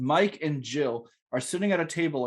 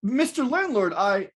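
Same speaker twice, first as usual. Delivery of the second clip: lightly acted higher-pitched pleading tenant; cornered tenant; 6 semitones higher, 3 dB louder than usual. Danny answering his angry landlord, mildly flustered. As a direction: try to get a word in, a touch flustered. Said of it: "I'm gonna be Danny".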